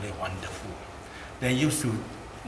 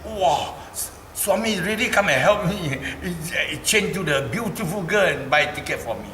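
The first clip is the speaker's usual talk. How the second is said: amazed mother; 7 semitones higher, 4 dB louder than usual. Retold or imitated. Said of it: "my mother got shocked".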